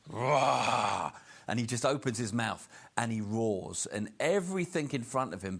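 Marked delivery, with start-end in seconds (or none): 0.1-1.1 imitated lion roar. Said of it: "where Aslan roars".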